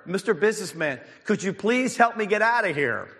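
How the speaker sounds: high-pitched appeal to a passer-by; acted plea for help. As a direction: call up pleadingly, voice rising high. Trapped man calls to businessman.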